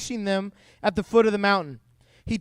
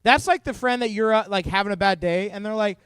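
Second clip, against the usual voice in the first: ordinary talk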